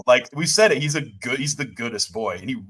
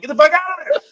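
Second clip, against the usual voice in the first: much higher, louder mock yell; yelling at the screen; 6 dB louder, faster than usual. Fan reliving yelling at the TV during the scene, mock yell. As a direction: shout at the characters to flee. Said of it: "And I'm like".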